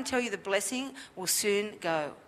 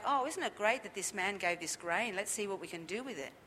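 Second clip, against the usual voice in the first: much softer, lightly voiced appreciation; imagined grateful response; 2 semitones lower, 8 dB quieter than usual. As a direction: toss off a quiet, mock grateful reply. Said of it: "Not like".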